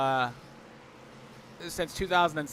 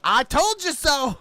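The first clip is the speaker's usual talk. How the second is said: teasing; 9 semitones higher, 5 dB louder, slower than usual. Retold or imitated. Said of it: "He goes".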